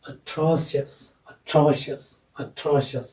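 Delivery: "atrocious" with the syllable before the suffix stressed; stress demonstration. Shown as a demonstration. Teacher modelling word stress for students, stressed middle syllable in "atrocious". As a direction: say "atrocious" leaning on the middle, "tro". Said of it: "ये वाला syllable accented होगा".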